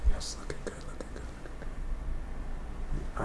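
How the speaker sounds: whispered self-praise, no voice. Real, not acted. Artist praising his own painting under his breath, whispering. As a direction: breathe it out to yourself, pleased. Pleased with the drawing, to himself.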